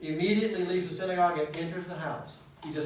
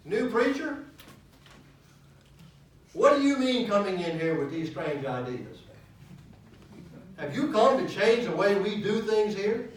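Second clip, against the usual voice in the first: higher, louder mock of a suspicious church member; indignant churchgoer voice; 7 semitones higher, 8 dB louder than usual. Preacher voicing the demon as a suspicious church member, loud and indignant. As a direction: play the outraged old-timer confronting the new pastor.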